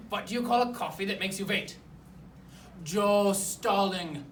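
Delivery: hammy Russian joke-teller; Russian accent. Acted. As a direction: joke told in mock Russian. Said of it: "tell it in a Russian accent".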